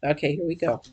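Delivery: ordinary talk, nothing marked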